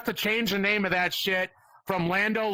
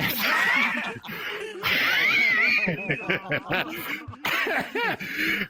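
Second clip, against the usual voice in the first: big laugh; 13 semitones higher than usual. Real, not acted. Laughs hard at heckled joke.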